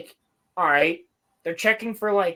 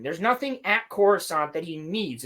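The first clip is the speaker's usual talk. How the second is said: ordinary talk, nothing marked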